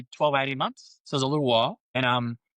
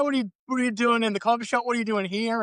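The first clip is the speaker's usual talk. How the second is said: mock surprise; 10 semitones higher than usual. Coworker amazed he was lawyer.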